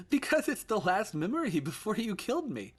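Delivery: put-on high child's voice, loud and cold; a little boy saying something terrible; eerie child voice. Ghost son accusing his father, high and childlike.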